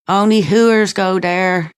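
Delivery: imitating a scornful mother's warning. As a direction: sneer it like a disapproving mother. Mother's scornful remark, retold.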